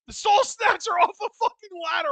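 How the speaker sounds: high, laughing yell of disbelief; excited shout. Real, not acted. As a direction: shout at the big finisher. Finishing move on screen.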